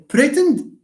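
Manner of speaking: "pretend" stressed high on PRE; stress on first syllable. Shown as a demonstration. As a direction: punch PRE, weak end to "pretend".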